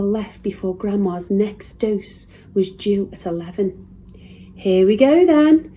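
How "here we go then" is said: high, loud eager cry; cried out. A narrator's reading. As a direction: jump up and call it out, higher and louder. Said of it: "cried George".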